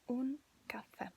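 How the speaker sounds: "caffè" said carefully in Italian, accented final è; Italian pronunciation. Shown as a demonstration. Teacher showing how to order coffee, careful Italian pronunciation. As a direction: demonstrate "caffè" with its open final è. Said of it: "pronounce it correctly".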